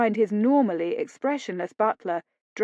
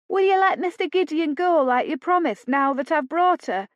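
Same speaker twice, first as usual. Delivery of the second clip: higher pleading wheedle; wheedling; 8 semitones higher, 4 dB louder than usual. A narrator's reading. Lila to captors, higher wheedling. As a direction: wheedle for your brother. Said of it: "in a wheedling voice".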